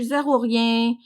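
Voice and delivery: ordinary talk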